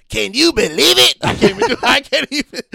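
high loud comedian rant; Chris Rock impression. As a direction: shout it like a stand-up. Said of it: "Can you do Chris Rock?".

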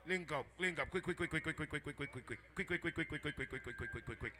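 low, soft, quickfire patter; rapid-fire urging. Real, not acted. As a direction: rattle off the repeats under your voice. Urging someone to link up.

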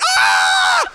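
put-on loud high childish scream; startled scream. Kid opening freezer to a dead deer, screaming. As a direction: shriek as if you just saw it. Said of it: "And I'm like".

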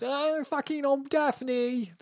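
high, slow mock Manchester accent; exaggerated, drawn-out Manchester accent. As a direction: put on a high-pitched Manchester voice. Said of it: "with a Manchester accent".

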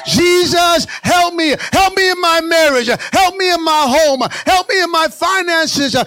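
loud, high, pleading preacher's cry; shouted prayer. Shown as a demonstration. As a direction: cry out for help at full force. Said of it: "Someone here is gonna say".

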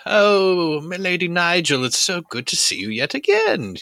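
loud, high, gushing hello; effusive greeting. Acted. Archimonde greets Lady Nigel at the party, loud and effusive.

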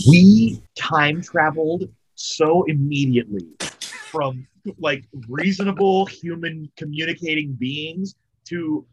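ordinary talk, nothing marked

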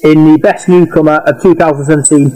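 upbeat host intro voice; podcast presenter read. Acted. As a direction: announce it like a radio host. Presenter announces award winners.

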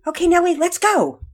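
high, loud rallying call; encouraging shout. A narrator's reading. Dad to daughter from the dugout, encouraging shout. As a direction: shout to get the pitcher going. Nervous dad cheers pitcher on.

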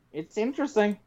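ordinary delivery

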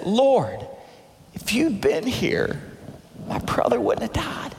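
soft, slow, grieving plea; hushed lament, acted with restraint; broken with sadness. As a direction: voice Mary's quiet heartbreak. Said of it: "Mary's so broken, she's so sad".

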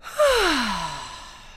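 long, full exhaled sigh; dramatic sigh. Shown as a demonstration. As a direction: let out a big sigh. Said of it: "Out with a big sigh".

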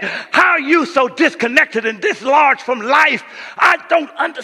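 loud, high, shouted preaching; full-force preaching. Acted. Pastor voicing baffled family members to the church, loud and high. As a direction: thunder at the congregation.

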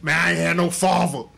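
put-on New York accent, laughing; mock New York accent with a laugh. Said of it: "say it in a New York accent".

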